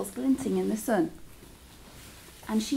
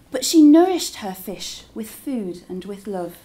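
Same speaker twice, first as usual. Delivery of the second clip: usual speaking voice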